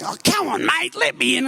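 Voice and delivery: lightly voiced drunk pleading to get in; mild mock drunk wheedle; pleading bogan. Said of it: "the Bogan was saying".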